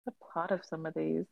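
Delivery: half-voiced musing under her breath; muttering to herself. Real, not acted. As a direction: repeat it quietly to yourself while thinking. Repeating the question to herself.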